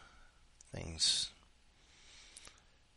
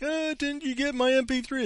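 mock sender voice. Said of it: "And then it's like".

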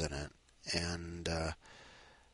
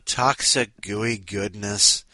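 savouring; 8 dB louder, slower than usual. Real, not acted. Host savours the story's phrase.

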